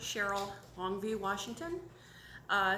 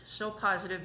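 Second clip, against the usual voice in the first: ordinary delivery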